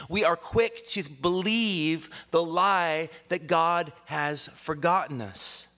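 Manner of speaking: earnest, ringing preacher's cadence; preaching. Real, not acted. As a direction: proclaim it to a congregation, stretching the key words.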